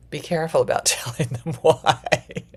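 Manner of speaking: loud, through real laughter; real amused laugh in the words; laughing caution. Real, not acted. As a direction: break up laughing as you warn them. Laughs while giving a caution.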